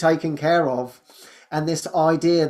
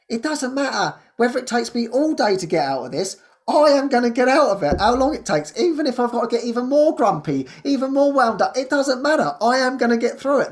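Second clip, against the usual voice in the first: determined; 7 semitones higher than usual. Real, not acted. Vowing to beat her grumpy morning, fiercely determined. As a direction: declare you'll get through it no matter what.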